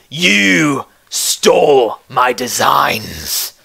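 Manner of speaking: heavy, drawn-out accusing words; slow angry accusation. Acted. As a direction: accuse a rival, word by word. Blacksmith accuses his rival.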